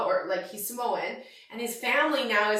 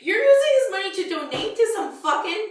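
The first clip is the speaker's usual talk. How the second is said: very high, loud, half-laughing outburst; retold rant; 7 semitones higher, 5 dB louder than usual. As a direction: tear into her with disbelief.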